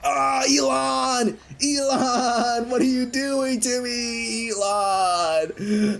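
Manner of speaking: high, drawn-out wail at Elon; anguished cry. Acted. Sonic in agony begging Elon, high wailing cries. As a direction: cry out in pain, stretching the words.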